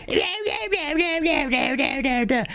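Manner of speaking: very high-pitched mock VTuber babble; cartoonish imitation of a screechy streamer; screaming VTuber impression. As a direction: squeal like an over-excited streamer, mockingly. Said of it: "every single VTuber when they're talking".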